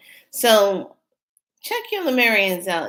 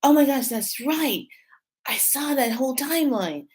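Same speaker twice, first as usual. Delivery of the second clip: higher, awed oh my gosh; awed realization; 7 semitones higher than usual. Retold or imitated. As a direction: speak as a vision comes back to you. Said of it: "I was just like".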